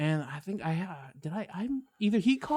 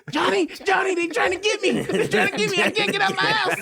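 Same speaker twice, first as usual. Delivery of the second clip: mock panic; 4 dB louder than usual. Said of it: "I'm gonna get a phone call".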